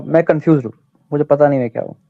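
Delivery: usual speaking voice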